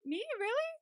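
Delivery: squeaky high mock-astonished Me; touched disbelief. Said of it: "And he's like".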